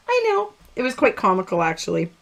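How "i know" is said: cheeky agreement. Retold or imitated. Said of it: "And she goes".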